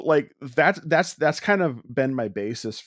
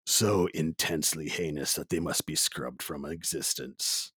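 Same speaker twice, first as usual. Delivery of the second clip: ominous movie-trailer read, low in pitch; movie guy voice; 4 semitones lower than usual. Acted. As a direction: intone it gravely like a trailer voice-over. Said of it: "decided to go with my movie guy voice".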